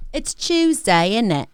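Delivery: slow, lifted British 'It's Tuesday, isn't it?'; a hammed-up meme British voice, stretched out; repeating an online meme in a mock British accent, playful; British accent impression. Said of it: "British people be like".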